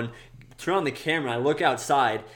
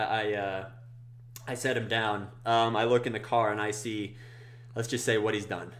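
normal talking voice

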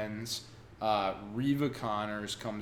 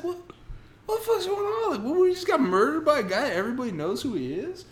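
very high, loud mock disbelief; bewildered outburst; 5 dB louder, faster than usual. [name] learning a famous killer murdered them, bewildered mock outburst. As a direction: squeal it fast, hammed-up confusion.

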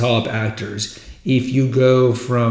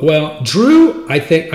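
hammed-up British accent, louder and higher; bad British accent; 5 semitones higher, 5 dB louder, slower than usual. Podcaster starts quoting an actor about his co-star, bad British accent. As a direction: attempt a British accent, badly. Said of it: "my really bad British accent".

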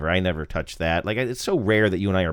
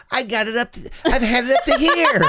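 much higher voice, comic Leno whine; Jay Leno impression; 13 semitones higher than usual. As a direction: play an exasperated old comic. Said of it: "I really like your Leno".

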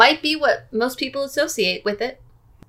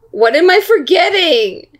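exasperated; 6 semitones higher, 4 dB louder than usual. Real, not acted. Stuck on clue, repeats question louder.

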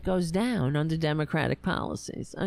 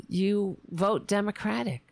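ordinary talk

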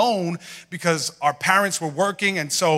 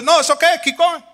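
mom's voice; 11 semitones higher than usual. Retold or imitated. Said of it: "she goes".

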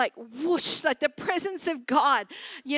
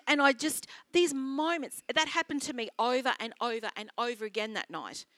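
usual speaking voice

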